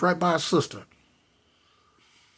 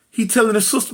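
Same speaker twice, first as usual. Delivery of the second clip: usual speaking voice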